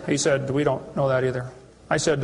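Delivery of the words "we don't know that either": a lower, hesitant professor; professor's voice. Retold or imitated. Professor admitting ignorance to the questioner, lower. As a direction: drop down into the cornered professor.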